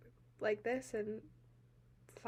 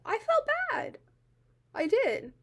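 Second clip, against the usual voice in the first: real upset, voice leaping high and louder; upset admission; 11 semitones higher, 5 dB louder than usual. Real, not acted. She admits she felt bad for him, upset and insistent. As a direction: insist on it, pitch jumping up in distress.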